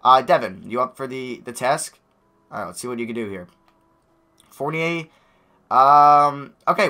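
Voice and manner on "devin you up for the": normal talking voice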